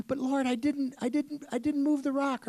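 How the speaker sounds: a high, put-on pleading man's voice; fretful George. George frets to the Lord that he failed, high and pleading. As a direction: whine it like a worried old man.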